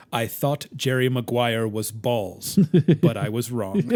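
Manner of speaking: slow, lightly voiced captain; weighty mock-solemn admission; a hint of an imitated commander, measured out slowly; Captain Sisko. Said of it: "you can just hear Sisko's voice".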